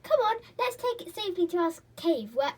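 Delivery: high, loud scheming gnome; greedy gnome. A narrator's reading. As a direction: hurry off with loot. Gnome speaks.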